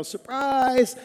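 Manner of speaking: high, drawn-out party cry; mock yell. Emcee rehearsing the party greeting, mild mock yell. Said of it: "we're gonna yell".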